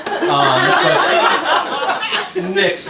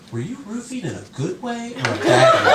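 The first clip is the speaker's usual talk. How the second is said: lightly voiced wife, pitched up; interjecting wife; 6 semitones higher than usual. Wife interjecting in the friends' conversation, lightly mimicked curiosity. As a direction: ask it as a higher, innocent question. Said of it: "my wife interjects into the conversation".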